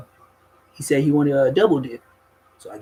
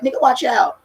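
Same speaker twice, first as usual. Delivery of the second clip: warning; 8 semitones higher, 4 dB louder than usual. Retold or imitated. Warning man grabbing his pistol.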